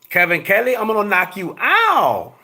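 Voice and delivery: mock fighter taunt.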